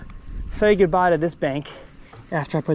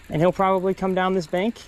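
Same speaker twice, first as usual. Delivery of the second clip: ordinary talk, nothing marked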